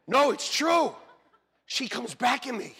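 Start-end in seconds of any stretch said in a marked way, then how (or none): none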